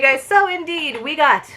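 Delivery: usual speaking voice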